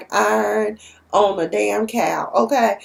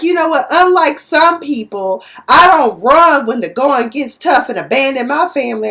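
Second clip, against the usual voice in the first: higher, louder accusing comeback; indignant retort; 4 semitones higher, 6 dB louder than usual. Retold or imitated. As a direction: throw the accusation at your sister. Nova fires back at Charlie.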